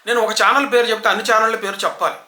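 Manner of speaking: usual speaking voice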